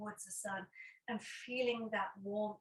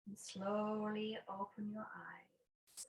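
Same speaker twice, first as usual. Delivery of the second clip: guided meditation. Acted. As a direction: guide them unhurriedly into noticing the breath. Leads a breathing meditation.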